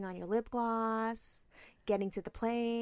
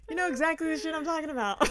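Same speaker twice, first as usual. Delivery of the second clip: high, laughing through it; laugh-crying; 7 semitones higher, 5 dB louder than usual. Real, not acted. Friend laughing so hard the voice cracks, high.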